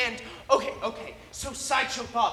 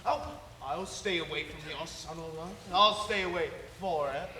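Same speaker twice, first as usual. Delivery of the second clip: low, softer rehearsal of the threat; villain impression; 7 semitones lower, 3 dB quieter than usual. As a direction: try the villain line quietly and low. Practises the villain's line again.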